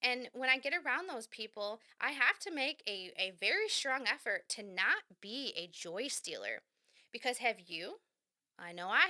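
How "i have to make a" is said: ordinary talk, nothing marked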